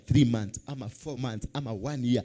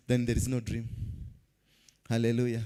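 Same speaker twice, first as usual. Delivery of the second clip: ordinary talk, nothing marked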